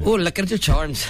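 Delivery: badly faked Irish leprechaun; bad Irish accent. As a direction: attempt Irish and miss it. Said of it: "can't do a real good Irish".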